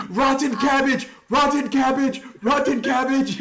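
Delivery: high, loud, laughing repeated yell; panicked yelling. Acted. Renard yelling the panic word, high and laughing. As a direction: shout it again and again, half laughing. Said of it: "he keeps yelling the panic word".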